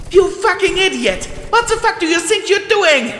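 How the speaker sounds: high, loud enraged shout; furious yell. Acted. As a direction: scream abuse at her. Soldier mare furious at Twist.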